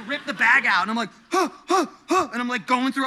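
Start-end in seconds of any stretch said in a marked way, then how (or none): 1.3-2.3 high imitated gasping; acted hyperventilating; panicked gasps. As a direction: gasp in sharp separate bursts. Imitates own panicked breathing.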